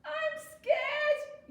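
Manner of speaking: high, loud wail; frightened yell. A narrator's reading. Raccoon hiding from the bear, panicked yell. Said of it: "yelled Raccoon".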